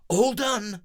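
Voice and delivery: high, loud announcement; proud hero. Acted. Breezy announcing the fix, proud.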